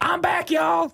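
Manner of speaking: very high jubilant comeback holler; Jesus. Acted. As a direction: crow it way up high like a showy return. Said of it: "that's my favorite Jesus catchphrase".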